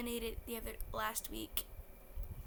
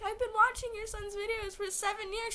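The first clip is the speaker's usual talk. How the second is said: high, tearful squeak of a fan; imitated crying voice; 10 semitones higher than usual. Crying fan explains herself to the dad, high mock-tearful voice. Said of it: "Why are you crying so hard".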